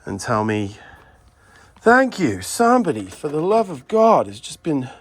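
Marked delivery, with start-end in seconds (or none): 1.8-4.9 high, loud swooping thanks; pitched-up imitation of a grateful follower; exasperated relief. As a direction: act out a follower's heartfelt relief. Acting grateful followers reaching out.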